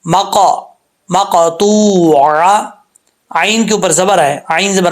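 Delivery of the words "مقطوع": slow مقطوع with a true ain, no hamza; clear ain recitation. Shown as a demonstration. Teacher warns students, slow مقطوع with ain. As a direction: stretch مقطوع from the throat. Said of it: "کہیں حمزہ کا sound نہ نکلے".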